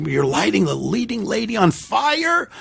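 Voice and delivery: panicked writer. Retold or imitated. Imitating writer Goldman fretting to the director about the fire scene. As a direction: squeal it out in mock panic.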